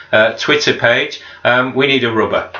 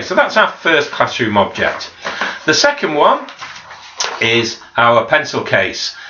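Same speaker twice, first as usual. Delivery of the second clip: ordinary talk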